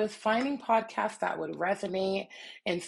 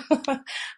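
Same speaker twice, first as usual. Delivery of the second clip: short laugh; 9 semitones higher than usual. Real, not acted. Laughs at her own tangent.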